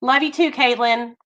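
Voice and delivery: ordinary talk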